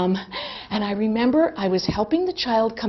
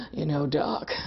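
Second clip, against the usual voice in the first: mock sexy voice; 7 semitones lower, 6 dB quieter than usual. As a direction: lower and softer sultry tease. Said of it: "my deepest sort of sexual voice".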